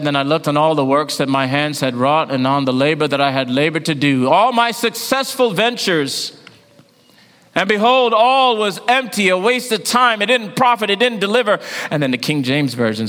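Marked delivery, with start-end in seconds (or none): 0.0-11.6 preaching at full force. Acted. Preacher voicing Solomon's empty riches, in full preaching voice. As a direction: cry out Solomon's regret from the pulpit.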